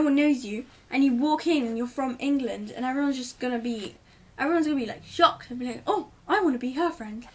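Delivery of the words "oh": ordinary delivery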